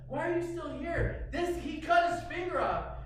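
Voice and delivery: alarmed wife pleading; exasperated wife. Retold or imitated. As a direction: demand action for her husband. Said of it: "She's like".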